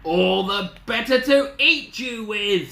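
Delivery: slow, loud, high-pitched snarl; snarling wolf. Acted. Wolf about to pounce on Little Red, loud snarl. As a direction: snarl it with menacing relish. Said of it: "snarled the wolf".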